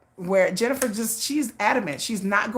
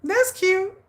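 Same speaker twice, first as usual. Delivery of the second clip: high delighted aside; higher delighted remark; cute reaction; 10 semitones higher than usual. Real, not acted. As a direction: coo over the comment. Reacting to chat.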